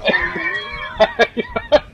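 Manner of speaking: very high-pitched giggle; laugh. Real, not acted. Player amused by bad options, high giggle.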